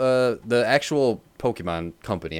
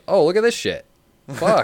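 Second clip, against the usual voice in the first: high, louder burst of amazed delight; acted excitement; 11 semitones higher, 5 dB louder than usual. Player stumbles on a free Game Pass game, excited. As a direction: blurt it out like a thrilled kid.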